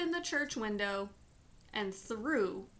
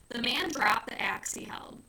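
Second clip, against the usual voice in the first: usual speaking voice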